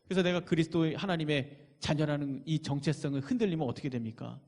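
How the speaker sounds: ordinary delivery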